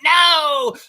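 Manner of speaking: high, loud wail of a thwarted schemer; Mister Burns. Acted. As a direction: cry out in mock despair at a rival's success. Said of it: "like Mister Burns".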